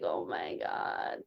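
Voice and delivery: hushed and slow, voice dropped away; overcome. Retold or imitated. Mother recalling crying with her daughter, hushed and overcome. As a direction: say it low, barely voiced. Overcome, retelling the tearful moment.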